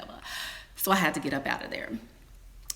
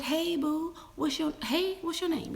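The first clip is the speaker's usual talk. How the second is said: mock sweet greeting; 11 semitones higher than usual. Flirty greeting to husband's date.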